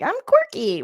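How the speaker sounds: high, perky mock quote; squeaky-high mimicry of someone being quirky. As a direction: put on a high "I'm quirky" voice. Mocking a quote about dressing oddly.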